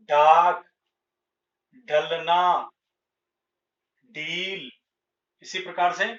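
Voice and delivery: each word spoken loud and separately, ढ/ड hard at the start, ढ़ flapped inside words; teacher modelling the words one by one; clear model reading. Shown as a demonstration. Teacher reading the ढ and ढ़ word list aloud for the class. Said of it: "ऐसा हम करेंगे".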